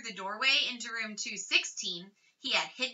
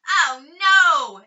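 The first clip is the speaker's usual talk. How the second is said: loud, higher dismayed cry; exclaiming; 5 semitones higher, 13 dB louder than usual. A narrator's reading. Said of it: "exclaimed the mother".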